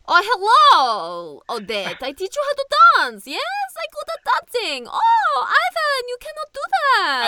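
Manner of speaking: high, exaggerated European accent on a strange child character; loud, hammy mock European voice with stretched vowels; vaguely European accent. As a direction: put on a silly, high-pitched European accent. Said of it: "I give them vaguely European sounding accents".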